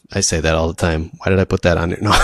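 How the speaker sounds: normal talking voice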